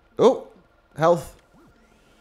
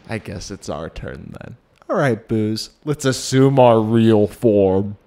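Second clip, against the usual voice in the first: louder grand villain announcement; scheming ghost voice; 6 dB louder than usual. Pretend. Voicing ghost boss.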